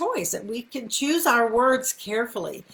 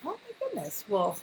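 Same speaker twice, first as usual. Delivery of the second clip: marvel, then ask to hear more; delighted surprise; 5 dB louder than usual. Shown as a demonstration. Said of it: "to do it, like".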